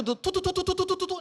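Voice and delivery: rapid high tut-tut-tut like machine gun fire; machine gun noise. Retold or imitated. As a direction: rattle it off like a machine gun spraying. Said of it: "有点像被那个机关枪扫过了一样".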